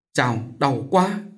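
slow, understated moan; moaning in pain. A narrator's reading. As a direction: draw the words out, hurting. Cô ôm bụng rên đau. (rên rỉ vì đau)

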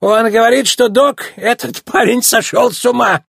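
very high, gleeful ridicule; hammed-up hysterical scoffing; mock hysterical mirth. A narrator's reading. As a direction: squeal it as if helpless with mirth. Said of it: "истерически хохотал".

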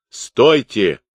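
sharp shouted command; commanding halt. A narrator's reading. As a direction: call a halt over a crowd. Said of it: "остановил всех Каторжный".

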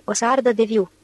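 ordinary delivery

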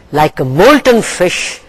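usual speaking voice

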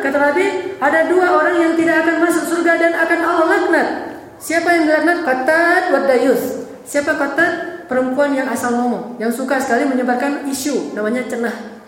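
ordinary talk, nothing marked

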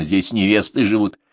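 plain reading voice

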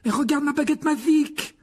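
high, dismayed cry rising at the end; upset, high-pitched lament; distressed wail. Acted. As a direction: wail over a precious broken thing. Ron distressed over broken wand.

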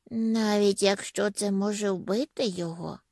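plain reading voice

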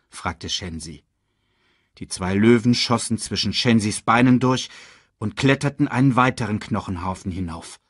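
usual speaking voice

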